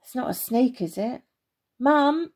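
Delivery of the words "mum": higher, louder call for Mum; boy about to call his mother. A narrator's reading. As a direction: start to shout for Mum. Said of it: "he started to call".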